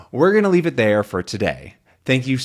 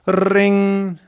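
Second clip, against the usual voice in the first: loud, high ringing noise; imitated doorbell; 10 semitones higher, 6 dB louder than usual. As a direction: trill it like a doorbell.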